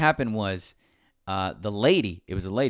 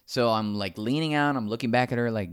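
usual speaking voice

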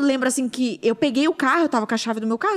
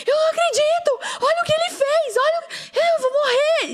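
very high, wailing re-enacted cry; retold hysterical scream. Mother screaming to husband on the phone, hysterical. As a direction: wail it out high, beside yourself. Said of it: "eu gritava no telefone".

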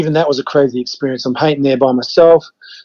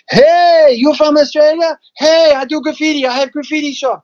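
high, excited imitation of an eager friend; gleeful high-voiced impression of a welcoming stranger; big-smile greeting; 13 semitones higher than usual. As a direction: call out with a big smile, pitched way up. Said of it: "comes running out with a big smile".

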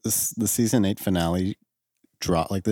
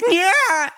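high, loud mimicking yelp; mimicked noise; 4 dB louder than usual. Co-host mocking the noise the other one made, high mimicking yelp. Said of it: "You actually went".

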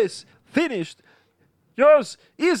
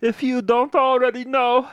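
put-on a young man's shaky farewell video, held together; grieving streamer. Jason tells his followers Liam is dead, tearful. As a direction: keep it together through the tears.

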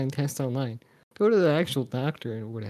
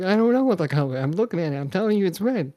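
raised pitch, mock-defensive insistence; flustered protest; 6 semitones higher, 5 dB louder than usual. Patient answering a yelling doctor at color test, flustered protest. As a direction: argue back as the flustered patient, retold. Patient arguing back in retold quarrel.

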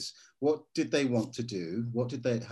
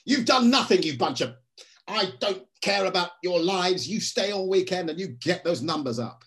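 high, loud boss berating his team; mock tirade at the team, much higher and louder; imitating a ranting boss; 7 semitones higher, 5 dB louder than usual. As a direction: yell at the staff like a tyrant manager. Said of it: "he'd come out and go".

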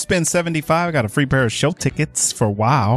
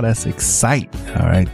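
normal talking voice

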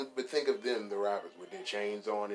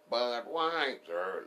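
drawn-out mock ad call; much slower than usual.

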